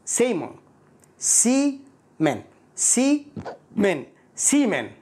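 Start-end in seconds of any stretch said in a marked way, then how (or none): 1.2-2.4 سيمن said slowly, first vowel short like semen; drawn-out سيمن with no final o sound; careful English pronunciation of سيمن; pronunciation demo. Shown as a demonstration. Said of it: "الكلمة التالية". 2.7-4.0 سيمن stretched slowly and clearly; drawn-out سيمن, each sound distinct; careful slow English pronunciation; pronunciation demo. Shown as a demonstration. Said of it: "الكلمة التالية".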